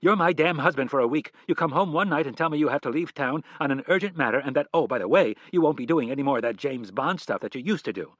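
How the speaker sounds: loud, high furious tirade; wife yelling at her husband; angry. Acted. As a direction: let him have it. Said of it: "looked angrily at her husband".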